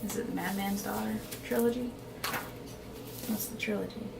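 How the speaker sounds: very soft aside to oneself; quiet self-questioning. Real, not acted. Vlogger puzzles over a series name between takes, quiet self-questioning. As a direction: wonder softly, off the record.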